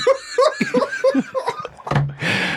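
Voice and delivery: high-pitched helpless laugh; real laughter. Real, not acted. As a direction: crack up for a couple of seconds. Cracking up at fishing in hell.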